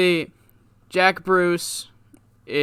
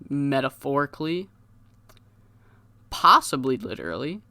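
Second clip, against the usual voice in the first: usual speaking voice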